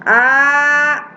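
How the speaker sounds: steady, drawn-out "A"; long mad. Shown as a demonstration. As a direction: stretch "A" as a sustained chant. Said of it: "mad badal".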